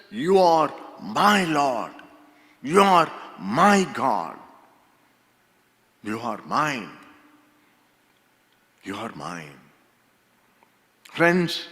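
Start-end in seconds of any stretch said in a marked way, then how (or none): 0.1-9.6 devout confession. Acted. Thomas's confession of personal faith.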